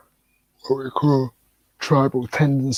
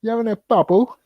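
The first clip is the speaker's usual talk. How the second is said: high-pitched slangy challenge; mock indignant mate; 11 semitones higher than usual. Trading slang with a mate, mock indignant. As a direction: play affronted, pitched right up.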